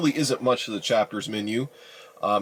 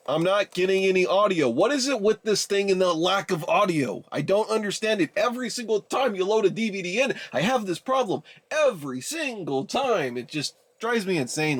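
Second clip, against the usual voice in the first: higher-pitched, fed-up complaining; exasperated rant; 6 semitones higher than usual. Real, not acted. Vlogger ranting to viewers about missing DVD audio, exasperated. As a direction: vent your frustration at a broken gadget.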